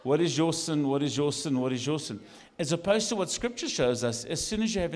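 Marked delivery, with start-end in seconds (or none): none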